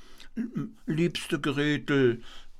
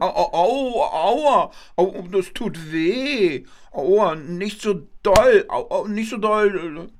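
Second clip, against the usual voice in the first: high, loud hammed-up ouch cries; mock pain cries; 6 semitones higher, 5 dB louder than usual. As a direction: overplay the pain like a puppet. Kasper gets beaten, fake pain. (übertriebenes Aua-Jammern, hoch und laut)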